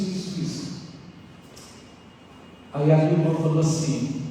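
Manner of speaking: normal talking voice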